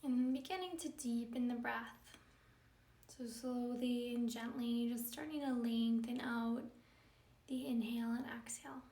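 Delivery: calm, measured yoga-teacher meditation delivery; guided meditation. Acted. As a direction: guide them gently into stillness, leaving space between phrases.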